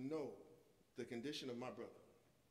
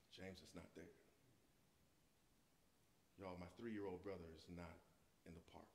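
hushed dread; 9 semitones lower, 12 dB quieter than usual. Retold or imitated. Realizing lost brother.

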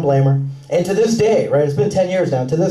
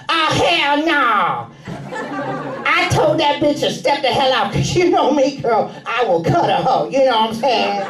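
put-on sassy, higher tough-girl voice; higher sassy girlfriend trash talk; ballsy girl talk; 6 semitones higher than usual. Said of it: "blurt out something ballsy like".

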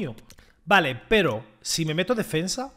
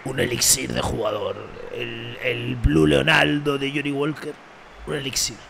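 low, slow hammed-up ad voice, fading into a whisper; mock whisky ad; 7 semitones lower than usual. Streamer hamming up a whisky advert voice. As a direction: sell it like a luxury liquor advert.

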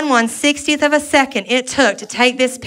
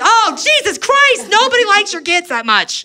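high, exasperated mock rant, slowing then racing; exasperated outburst; 9 semitones higher than usual. Comic to a photo-studio customer, a high exasperated rant. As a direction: blurt it out like you can't believe the customer. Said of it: "I'm like".

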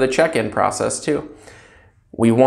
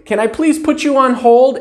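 higher, louder rushed hold request; wrong way hold request; 11 semitones higher, 4 dB louder than usual. Shown as a demonstration. Clerk wrongly putting a caller on hold. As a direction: brush the caller off. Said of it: "Don't say".

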